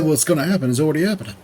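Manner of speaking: fast repeat. Retold or imitated. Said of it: "I said".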